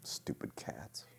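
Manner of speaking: soft, slow whispered mutter; whispered aside. Real, not acted. Grumbling about the cats in a whisper. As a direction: hiss it under your breath.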